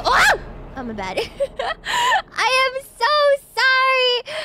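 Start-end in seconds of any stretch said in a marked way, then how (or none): 0.0-0.4 sudden high, loud exclamation; abrupt high outcry; startled yelp. Real, not acted. Startled in mid-apology. 1.2-2.3 high-pitched burst of giggles; high helpless giggle; laughing. Real, not acted. Laughs after own mishap. 2.4-4.4 slow, high, loud drawn-out sorry; emphatic apology just after laughing; giddy apology. Real, not acted. Apologizes while breaking up laughing.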